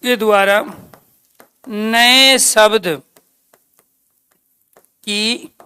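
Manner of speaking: slow, word by word, long pauses between; slow dictation. Shown as a demonstration. Teacher dictating the function of sandhi slowly to students. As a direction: spell it out slowly for note-taking.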